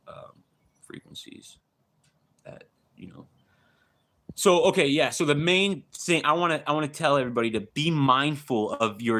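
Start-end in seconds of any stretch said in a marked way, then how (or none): 0.1-3.3 whisper. Real, not acted. Conspiratorial aside about weapons.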